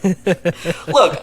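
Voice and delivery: ordinary talk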